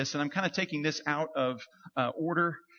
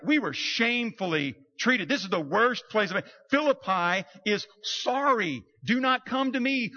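higher, louder, outraged; mock outrage review; emphatic complaint; 6 semitones higher, 5 dB louder than usual. Paul's review of Philippi, outraged and louder. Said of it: "It's like".

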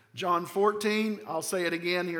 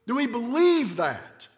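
normal talking voice

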